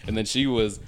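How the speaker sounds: normal talking voice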